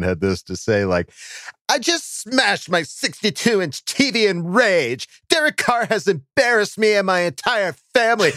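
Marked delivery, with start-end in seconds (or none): 1.7-8.3 put-on high whiny Valley Girl voice. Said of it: "the whiny girl voice".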